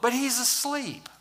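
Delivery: usual speaking voice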